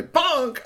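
high, loud comic bonk; impact sound effect. A narrator's reading. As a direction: pop it out like a cartoon hit. Imitates stone hitting toy.

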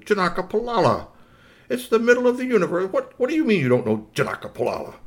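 high, incredulous robot boss; indignant Billy Rob. Acted. Robot boss shocked his home is unknown, indignant. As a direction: exclaim it, affronted and high-pitched.

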